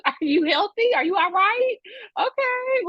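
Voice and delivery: mock caring questions. Mock check-in on men.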